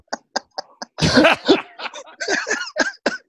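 long, high burst of laughter; high-pitched, drawn-out laugh. Real, not acted. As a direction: crack up laughing. Laughs after own story.

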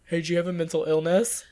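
mock blunt interrogation; imitating a tactless ghost hunter; blunt version. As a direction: ask it with no sweetness at all. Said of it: "But I'm not like".

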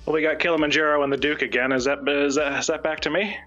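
ordinary talk

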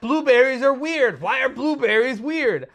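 high, loud, rushed excited rambling; racing thoughts. Retold or imitated. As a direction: babble like your brain won't stop. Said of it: "I'm like, I can't stop thinking".

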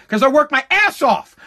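usual speaking voice

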